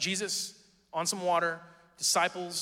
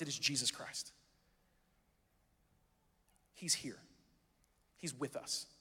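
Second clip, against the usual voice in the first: quiet reassurance; 4 semitones lower, 9 dB quieter than usual. Real, not acted. Reassuring after the sermon's build.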